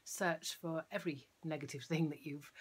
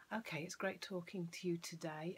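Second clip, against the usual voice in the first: ordinary talk, nothing marked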